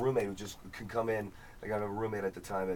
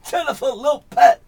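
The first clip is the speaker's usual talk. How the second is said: "[name]" said much higher, louder and slow, with the big inflection; 13 semitones higher, 15 dB louder, slower than usual. Shown as a demonstration. Showing off the [name] inflection, slow high impression. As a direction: stretch "[name]" with a swooping lilt. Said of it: "just the word".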